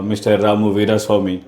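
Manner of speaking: usual speaking voice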